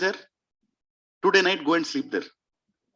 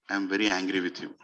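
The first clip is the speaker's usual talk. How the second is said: gentle reproach; 10 semitones lower, 6 dB quieter than usual. Acted. Ram speaks gently to his mother.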